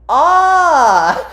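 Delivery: high, loud held scream; hammed-up drawn-out shriek; mock scream. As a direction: imitate a cartoon heroine's scream. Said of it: "that's what her scream sounds like".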